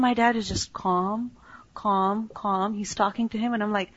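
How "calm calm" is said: normal talking voice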